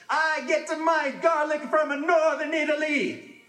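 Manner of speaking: high, loud, slow mock-Italian boast; arguing Italian. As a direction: puff up and brag about your garlic, pitched high. Italians yelling over garlic.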